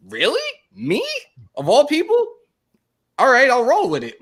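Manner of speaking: high, loud "Me? Of all people?"; stunned disbelief. Retold or imitated. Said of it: "I was like".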